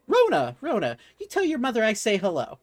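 high and quick, gushing over a familiar name; delighted shopkeeper. Acted. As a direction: beam at the customer.

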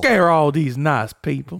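slow movie sheriff impression; Bill Sharp. Friend quoting Django's opening, slow Bill Sharp impression. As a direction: draw the words out like a film lawman. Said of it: "I like Bill Sharp at the beginning".

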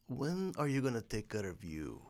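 hushed, tender salesman's question; soft sell. Retold or imitated. Product guy coaxing a customer, softly.

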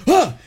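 sharp startled gasp; scared gasp. Retold or imitated. Girl gasps in fright at a noise, sharp gasp. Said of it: "she goes".

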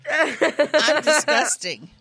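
drawn-out laughing whine; slow laughing groan. Real, not acted. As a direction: stretch it out, cracking up. Laughing at herself.

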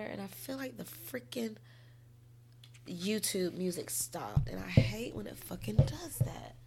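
real whisper, words barely voiced. Real, not acted. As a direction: hush it, as if not to be heard. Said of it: "y'all probably can't hear me".

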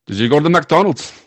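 a put-on stage Irish host greeting; poor Irish accent. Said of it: "our poor attempt at an Irish accent".

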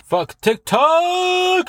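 very high, long drawn-out wail; mock-furious yell. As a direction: blow up at TikTok, stretching the last word. Ranting climax, high long yell.

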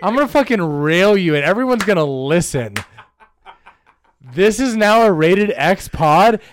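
loud, bold comic proclamation; hammed-up loud rant; mock ranting. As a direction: announce it loudly as a mock threat. Mock threat announced to the audience.

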